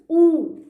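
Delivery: "oo" as one long, loud vowel; emphatic, held "oo"; vowel sound demo. Shown as a demonstration. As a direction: sound out "oo" as in moon. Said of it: "third one is O O".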